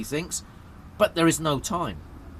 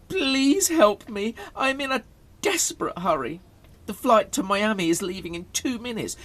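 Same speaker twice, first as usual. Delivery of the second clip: pathetic high-pitched begging; pleading; 7 semitones higher than usual. A narrator's reading. Harold begs a security guard to jump the queue, pleading. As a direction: beg desperately, pitched well up, leaning hard on each please. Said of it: "pathetic expression and pleading voice again".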